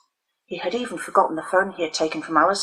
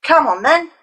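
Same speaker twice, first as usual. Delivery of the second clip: high, louder goading call; challenging; 9 semitones higher, 6 dB louder than usual. A narrator's reading. Dermot goading the girls to fight. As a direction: egg them on to fight. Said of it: "Dermot challenged".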